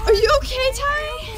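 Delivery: high, urgent check-in; alarmed concern. Acted. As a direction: exclaim with worry, voice shooting up.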